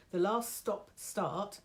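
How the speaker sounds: slightly husky, throat catching; slow, a bit croaky start; frog in throat. Real, not acted. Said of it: "I'm so sorry for the frog in my throat".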